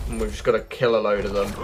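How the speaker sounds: ordinary delivery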